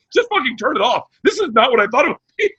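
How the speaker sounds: high-pitched dismayed host imitation; Opie. Radio host regretting the crying clip, dismayed impression. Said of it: "Opie's like".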